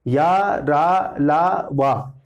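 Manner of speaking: slow drawn-out syllables ya ra la va; "या, रा" stretched long as a sound demo; sounding out consonants. Shown as a demonstration. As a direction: voice each syllable slowly for the class. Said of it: "ओष्ठ वाले होंगे".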